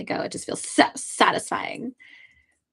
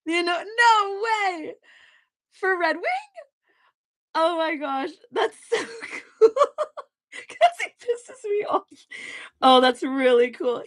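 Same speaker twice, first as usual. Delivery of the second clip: real, high, laughing delight; squealing through laughter; laughing excitedly; 8 semitones higher than usual. Real, not acted. Artist thrilled by the voice actor news, laughing high.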